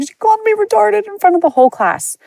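put-on high, strained voice of a sobbing kid; a hint of upset crying, high and tight; retold hysterical crying. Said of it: "I ran to him hysterical crying".

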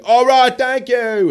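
high, loud rock-star sign-off; [name] impression. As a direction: shout it off stage like Jagger. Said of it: "Mick's like".

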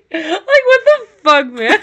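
high, cracking with laughter; tears of laughter; laughing. Real, not acted. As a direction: squeal it through laughter. Crying with laughter.

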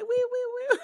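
very high squeaky wee wee wee; mock celebration noise. Asked for celebration audio, she squeals it herself, very high. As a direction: squeal like a party horn. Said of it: "audio for the celebration".

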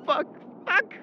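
high, frantic swearing under pressure; panic, the bandages are gone; panicked cursing. Acted. Out of bandages for Daniel, panicked swearing.

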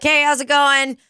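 perky hostess welcome; cheery server greeting. Retold or imitated. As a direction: greet them like a chirpy bartender. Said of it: "I'll just be like".

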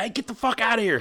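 mock furious bartender; angry bartender. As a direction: throw them out of the bar. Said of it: "Bartender's like".

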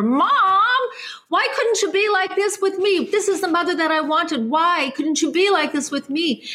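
high, tearful plea to her mother, voice breaking; crying. Real, not acted. Daughter crying as she pleads with her mother's vision. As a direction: ask it through real tears. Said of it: "I started crying as I was walking".

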